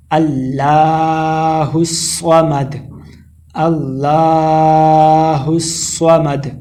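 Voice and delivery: slow, drawn-out tajweed chant; slow chanted Arabic recitation; Quranic recitation. Shown as a demonstration. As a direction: recite it melodically and unhurried. Recites verse slowly.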